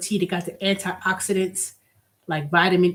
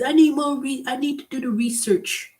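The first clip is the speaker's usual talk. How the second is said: mocking holdout voice; 6 semitones higher than usual. Ranting about vaccine skeptics, mocking their research excuse. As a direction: parody the excuse, pitched up.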